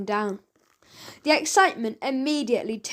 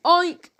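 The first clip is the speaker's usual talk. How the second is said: pig oink; 6 semitones higher, 5 dB louder than usual. A narrator's reading. Pig squeals at the car.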